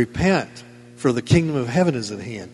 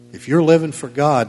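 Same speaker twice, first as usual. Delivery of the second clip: usual speaking voice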